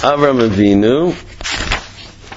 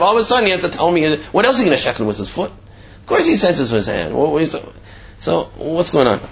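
ordinary delivery

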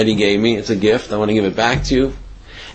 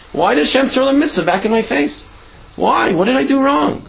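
anguished questioning; 12 semitones higher than usual. Retold or imitated. Crying rabbi asks why he's rejected.

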